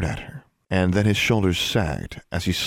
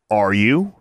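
growled question rising sharply, louder; growled threat; 5 dB louder than usual. A narrator's reading. Brother stepping closer to his sister, growled threat. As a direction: press it out as a threat, not a question. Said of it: "The last two words were growled".

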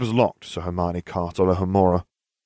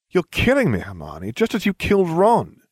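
smirking; 10 semitones higher, 5 dB louder than usual. Acted. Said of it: "I finished with a smirk".

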